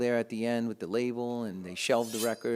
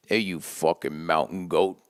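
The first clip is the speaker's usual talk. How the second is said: lower, slow gruff buddy voice; Pete on the phone; 4 semitones lower, 3 dB louder, slower than usual. Retold or imitated. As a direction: growl it like an old friend teasing. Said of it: "I hear someone say that to me".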